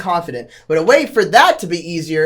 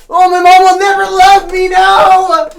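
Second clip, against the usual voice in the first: high, loud whiny wailing; mock tantrum; 10 semitones higher, 8 dB louder than usual. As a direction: throw a hammed-up tantrum.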